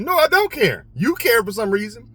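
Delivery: high-pitched acted rebuttal; defiant denial. Worker contradicting his manager, high-pitched defiant denial. Acted back-and-forth of retold quarrel.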